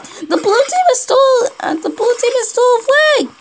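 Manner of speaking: high-pitched excited shout-out; urgent alarm. Real, not acted. Player alerting everyone about the blue team, alarmed. Urgently reporting flag theft.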